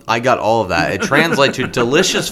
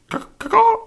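chirping bird person. Acted. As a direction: squawk it like a parrot. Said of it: "you gotta give him his call".

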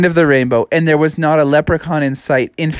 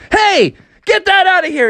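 very high, protesting character voice; indignant yell; 3 dB louder than usual. A narrator's reading. Frog confronting the British over tea, high indignant yell. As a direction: call out a high-pitched objection.

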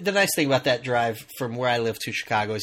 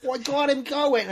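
high, slow, hammed-up New York accent; 12 semitones higher, slower than usual. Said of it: "thick, like, New York accent".

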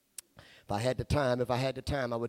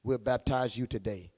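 normal talking voice

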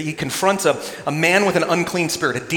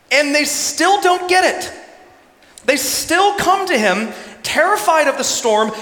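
raised-pitch pulpit fervour; impassioned preaching; 8 semitones higher, 3 dB louder than usual. Acted. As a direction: drive it home to the congregation. Preaching at full force.

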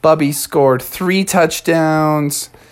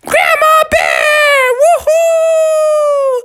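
very high squealing cheer; stretched-out joyful shriek; acted children greeting their grandma; excited kids; 4 dB louder, much slower than usual. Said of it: "the kids were all like".

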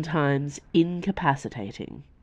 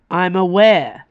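a louder, tight retort forced through gritted teeth; 3 semitones higher, 7 dB louder than usual. A narrator's reading. Dio, in pain, cutting off Nimue through gritted teeth. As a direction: bite the words off, holding back pain. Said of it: "with gritted teeth".

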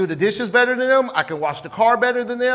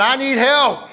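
loud, higher I need help; congregation repeat; 5 semitones higher, 4 dB louder than usual. Pretend. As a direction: call it out louder. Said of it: "Say it again".

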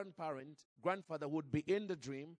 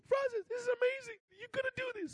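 much higher, thrilled call down the phone; excited shout; 4 dB louder, faster than usual. Retold or imitated. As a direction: burst out with amazed joy. Father calls narrator, thrilled.

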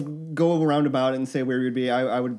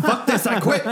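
mock outburst; 8 semitones higher, 3 dB louder than usual. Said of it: "It's like".

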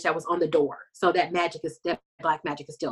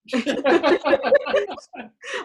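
high laugh; 10 semitones higher than usual. Real, not acted. Laughing at the joke.

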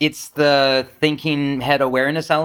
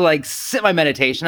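ordinary talk, nothing marked

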